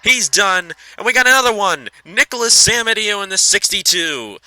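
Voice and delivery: high, loud, excited shouting; excited race call. Real, not acted. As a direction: yell the wreck as it happens. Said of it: "I'm trying to get as excited as I can".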